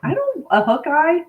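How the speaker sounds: baffled echo of an odd word; amused bafflement. Real, not acted. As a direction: stretch it into a rising question. Puzzled at unfamiliar word.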